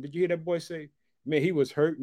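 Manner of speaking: normal talking voice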